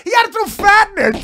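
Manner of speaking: high, loud protest amid laughter; comic indignation. Real, not acted. Comic objecting to being called fat, comically indignant. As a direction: shout it as a wounded complaint.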